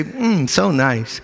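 soft, slow, unimpressed mm so nice; a lazy shrug of a compliment, slowly; mock polite admiration. Preacher mocks admiring gift wrap, soft and slow. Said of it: "Don't look at the wrapping and say".

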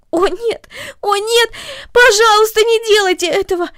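high, loud, panicked pleading; a terrified girl begging; frightened cry. A narrator's reading. Girl begs the mother not to touch her hair, in panic. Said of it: "закричала Корнели".

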